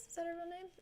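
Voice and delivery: high, very soft voice; small quiet echo. Real, not acted. Streamer wondering about co-host's name, small high echo. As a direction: repeat the question faintly, high. Repeats question to herself.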